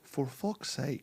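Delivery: slow, drawn-out Irish "For fuck's sake"; Irish accent. Shown as a demonstration. Actor shows his Irish trigger phrase in Irish accent. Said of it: "my trigger word is for Irish".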